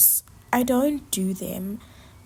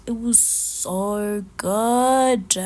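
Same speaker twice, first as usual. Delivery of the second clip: rapturous food praise; 5 dB louder, much slower than usual. Real, not acted. Raving about the pork belly.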